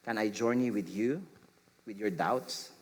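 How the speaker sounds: soft, low and tender; gentle answer. Shown as a demonstration. Parent gently answering a child's doubts, softly. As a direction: gently offer to walk with them. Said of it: "A gentle answer would be".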